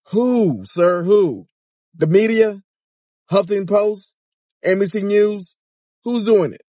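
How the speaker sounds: high, pressing questions; incredulous demand. Real, not acted. Demanding who uses his son.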